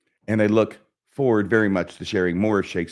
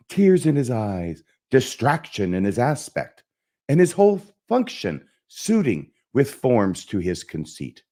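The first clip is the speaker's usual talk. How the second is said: Hamlet. Acted. Actor performing Hamlet's soliloquy for viewers, impassioned and theatrical. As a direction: declaim it with big swings in pitch. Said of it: "try and just perform the soliloquy here".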